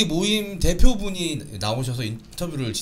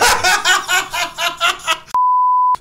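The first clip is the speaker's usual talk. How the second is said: loud laugh; 7 dB louder than usual. Real, not acted. Laughs at own remark.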